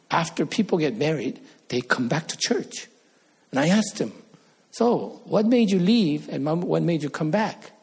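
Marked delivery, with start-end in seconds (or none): none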